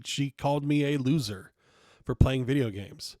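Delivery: ordinary talk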